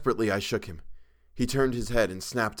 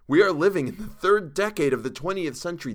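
higher-pitched, urgent reasoning; pleading; 9 semitones higher, 5 dB louder than usual. A narrator's reading. Narrator trying to quiet Chalmers, pleading urgently. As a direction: plead with him to see sense. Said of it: "I pleaded to quiet him".

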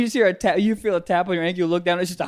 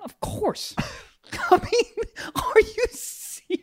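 laughing; 12 semitones higher, 5 dB quieter, slower than usual. Real, not acted. Incredulous laugh.

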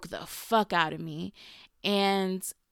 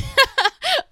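sudden high, loud self-mocking laugh; a loud high cackle at herself; burst of laughter; 13 dB louder than usual. Real, not acted. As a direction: laugh it off at your own expense. Laughs at calling herself crybaby.

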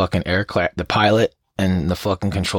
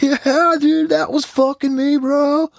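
very high, louder bro voice; mock jetpack guy; 4 dB louder, slower than usual. Jetpack guy bragging to the host, high and loud. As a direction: squeal it like a proud bro. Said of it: "and you're like".

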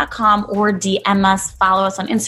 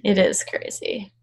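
whispered aside; 5 dB quieter than usual. Real, not acted. Whispering to her cohost that it is crazy. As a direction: hush it down to a whisper. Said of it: "whispered aside".

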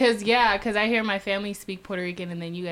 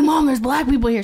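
excited call; 6 semitones higher, 7 dB louder than usual. Retold or imitated. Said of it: "I'm like".

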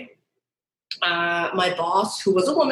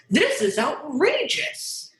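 exasperated; 7 semitones higher, slower than usual. Retold or imitated. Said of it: "being like".

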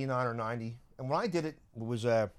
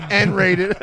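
laughing repeat; 6 semitones higher, 15 dB louder than usual. Real, not acted.

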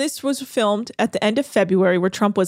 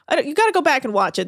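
ordinary delivery

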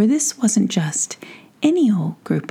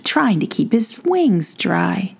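ordinary delivery, nothing marked

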